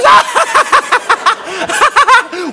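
very high, rapid-fire cackle; imitated laughing fit. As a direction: act out her helpless, mocking laughter. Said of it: "And she goes".

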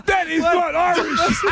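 high, slow incredulous protest; real amused outrage, drawn out; laughing disbelief. Real, not acted. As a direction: spell it out, laughing. Incredulous at supposed Irish accent.

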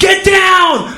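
high, very loud warning yell; action-hero shout. Acted. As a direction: yell it over mock gunfire.

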